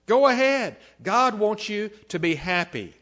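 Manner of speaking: high, loud coaxing in a put-on voice; tempter's urging. Preacher voices Satan egging on the tempted, urging. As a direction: urge them on, starting high and easing down.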